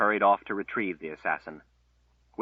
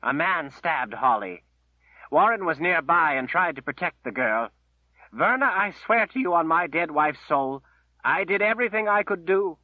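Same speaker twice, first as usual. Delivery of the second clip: higher, louder, voice tight with grief; choked with emotion; 8 semitones higher, 5 dB louder than usual. Acted. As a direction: plead through held-back tears. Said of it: "His voice was choked with his emotion".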